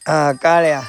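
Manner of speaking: ordinary delivery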